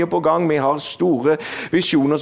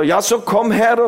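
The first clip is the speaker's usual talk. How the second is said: higher, impatient retort; exasperated, pitched up; irritated; 6 semitones higher, 4 dB louder than usual. Retold or imitated. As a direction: retell it as a stressed, grudging summons. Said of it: "Jeg var nesten irritert".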